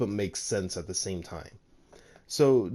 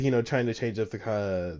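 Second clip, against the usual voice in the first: ordinary delivery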